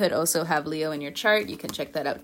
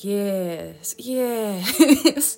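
smug; 3 semitones higher, much slower than usual. Pretend. Voicing fiery Virgos.

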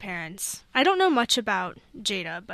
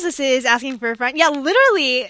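pilot announcer voice; 11 dB louder than usual. Pretend. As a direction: address the listeners like an airline pilot. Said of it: "airplane voice out".

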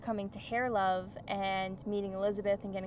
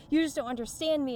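imitating a tearful complaint; 6 semitones higher, 6 dB louder than usual. Retold crying argument with Josh.

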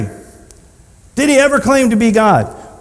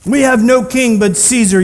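raised, higher-pitched retort; yelling back; 4 semitones higher than usual. Acted. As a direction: throw it back as a crowd would.

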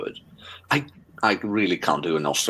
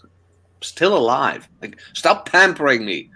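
annoyed retort; 7 semitones higher, slower than usual. Retold or imitated. Retold irritated reply to ex.

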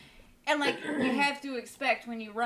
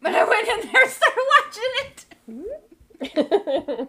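laughing; 11 semitones higher, 10 dB louder than usual. Real, not acted. Laughing at own story.

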